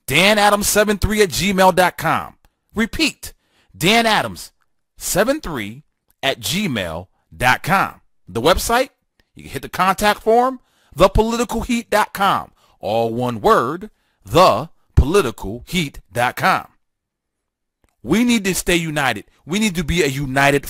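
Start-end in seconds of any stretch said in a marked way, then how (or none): 3.8-8.0 slow, spaced out, piece by piece; slow repeat. Real, not acted. Host repeats his email address for listeners, slowly and spaced out. 14.2-16.7 word by word, with gaps; deliberate repeat. Real, not acted. Host repeats his website for listeners, deliberate and word by word.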